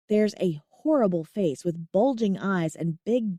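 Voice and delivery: usual speaking voice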